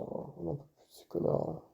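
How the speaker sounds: muttering curse under breath; whispered mutter. Real, not acted. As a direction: hiss it to yourself, annoyed. Muttering insult at the opponents.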